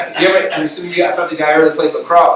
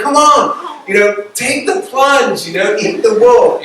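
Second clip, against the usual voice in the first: loud, higher egging-on cheer; acted spectator rallying a nervous jumper; crowd cheering; 7 semitones higher, 5 dB louder, slower than usual. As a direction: urge it on, drawing out the words. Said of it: "like, cheering for her".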